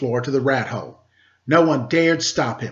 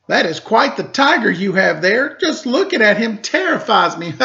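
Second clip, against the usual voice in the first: higher, sneering mock; mocking rat; 7 semitones higher, 4 dB louder than usual. A narrator's reading. Rat sneering at the Daimyo about his cat. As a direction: taunt him with fake fear.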